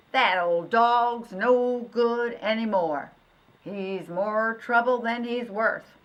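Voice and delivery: slow, loud farmer's verdict; fed-up farmer. Acted. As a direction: done with the old dog, say it heavily. Farmer gives up on dog.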